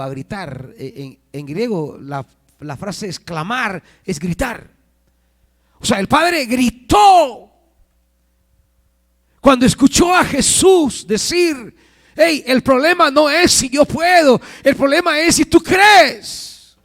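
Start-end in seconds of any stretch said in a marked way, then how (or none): 5.8-16.8 shouted, high-pitched preacher's delivery; full-force preaching. Acted. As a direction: cry out the retelling to the congregation.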